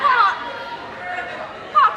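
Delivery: high, loud call of his name; loud pleading call; loud plea. Acted. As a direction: call out to calm him. Daughter pleading loudly.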